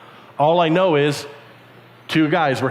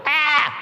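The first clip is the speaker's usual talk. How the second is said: startled yelp; 9 semitones higher than usual. Acted. Startled cry.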